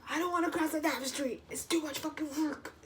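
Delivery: high, put-on whiny complaint; mock whine. Fighting her gut feeling over a street, mock whining. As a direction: whine in protest like a sulky kid.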